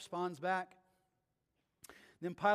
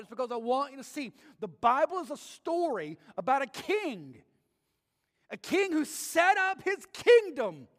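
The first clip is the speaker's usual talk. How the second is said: loud, higher preacher's build; fervent preaching; 5 semitones higher, 7 dB louder than usual. Acted. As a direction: drive the point home from the pulpit. Preaching at full force.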